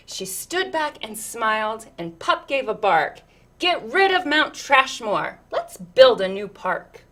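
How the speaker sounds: lilting, rhythmic read-aloud; picture book reading. Acted. Reading a picture book to children, lilting storyteller voice.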